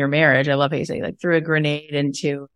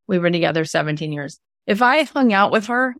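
normal talking voice

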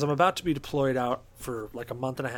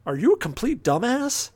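incredulous look; 8 semitones higher, 3 dB louder than usual. Retold or imitated. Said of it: "just the look of".

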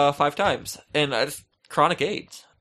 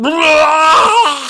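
mock vomit shout; 13 semitones higher, 10 dB louder than usual. Acting out vomiting again.

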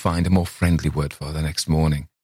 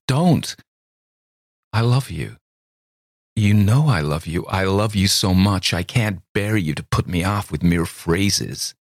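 imploring; 3 semitones higher than usual. A narrator's reading. Miss Thayer begs Campbell not to put her off, imploring. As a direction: beg him to believe you. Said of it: "an imploring".

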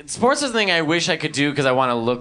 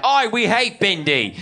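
put-on Australian accent; 7 semitones higher, slower than usual. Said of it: "they're like".

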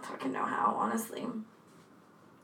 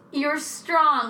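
louder, higher, scornful echo; mocking quote; 5 semitones higher, 5 dB louder than usual. Podcaster scoffing at attacker's words, mocking. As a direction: throw it back in disbelief, raised voice.

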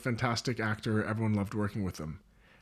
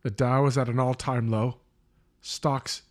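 bright, loud newscaster read; news anchor; 4 dB louder than usual. Acted. Mock news broadcast intro.

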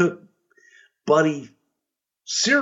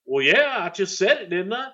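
smug heel riff; 7 semitones higher than usual. Retold or imitated. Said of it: "riffing on catchphrase".

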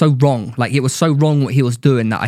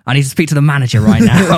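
louder, insistent mock complaint; Karen demand; 4 dB louder than usual. Demanding the manager after the club scuffle, full Karen. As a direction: act the entitled customer demanding service. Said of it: "this is very Karen".

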